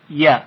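ی said as a quick "ya"; short fatha. Shown as a demonstration. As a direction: pronounce ی with a short a, cut off promptly. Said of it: "باید زود صدا قطع بشه".

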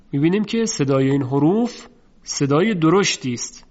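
usual speaking voice

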